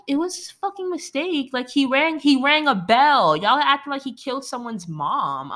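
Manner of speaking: exasperated protest. Retold or imitated. Viewer scolding townspeople over a bell, high exasperated protest.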